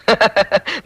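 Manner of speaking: short fatherly laugh; chuckle. Acted. Father to his daughter, a light chuckle. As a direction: chuckle before answering.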